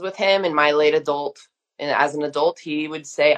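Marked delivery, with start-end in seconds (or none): none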